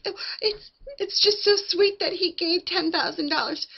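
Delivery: very high, tearful mock whimper; fake weepy sweetness. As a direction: imitate her crying to sound sweet. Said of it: "she's crying to make it sound like it's sweet".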